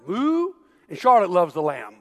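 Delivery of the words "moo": high little moo; squeaky baby moo; toddler moo. Retold or imitated. Twins' animal sounds.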